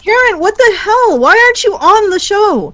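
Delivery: high, startled outburst; surprised. Real, not acted. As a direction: caught off guard, voice jumps way up. Karen unexpectedly shows up in chat.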